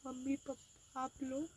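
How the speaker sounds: soft, hushed mock farewell to parents; quiet farewell. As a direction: murmur it as if leaving for good. Staged runaway farewell message. (धीमी आवाज़ में नाटकीय विदाई)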